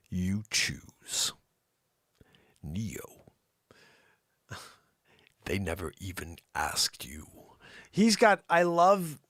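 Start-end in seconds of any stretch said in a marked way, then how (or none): 0.1-3.2 deep, portentous mentor impression; Morpheus. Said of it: "Morpheus's voice". 5.4-7.3 deep, half-whispered mentor impression; low and weighty, like a film sage; Morpheus. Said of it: "Morpheus's voice".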